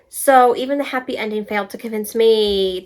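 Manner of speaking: ordinary talk, nothing marked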